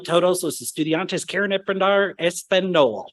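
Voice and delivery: put-on heavy American accent in Spanish; Peggy Hill. Showing the Spanish he avoided, thick American accent. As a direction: mangle the Spanish with broad American vowels. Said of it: "I didn't want to sound like Peggy Hill".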